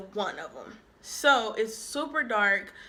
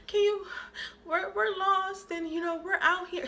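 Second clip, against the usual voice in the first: hammed-up sad begging, higher; fake crying plea; 8 semitones higher than usual. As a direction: beg the stranger, fake tears. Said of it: "I put on this little fake face, like, I'm crying".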